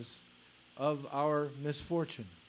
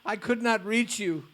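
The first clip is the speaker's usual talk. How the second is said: pained, louder lament with rising pitch; 4 semitones higher, 6 dB louder than usual. Retold or imitated. Madhavendra Puri lamenting to Krishna after the disciple left, pained lament. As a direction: pour out grief and indignation at once. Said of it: "he began to lament".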